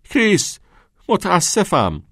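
high, loud called-out apology; drunken shout. A narrator's reading. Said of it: "با مستی رویش را برگرداند و داد زد". (داد زدن با صدای زیر و بلند)

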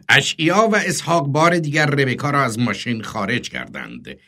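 ordinary delivery, nothing marked

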